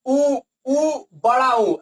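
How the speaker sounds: each उ sounded slowly and separately; vowel length demo. Shown as a demonstration. Teacher comparing vowel timing for students, slow separate उ. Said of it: "बहुत ही कम समय लग रहा है".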